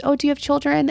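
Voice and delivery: friendly stranger. Retold or imitated. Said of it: "he goes".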